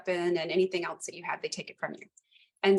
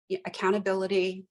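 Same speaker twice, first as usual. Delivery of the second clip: ordinary talk, nothing marked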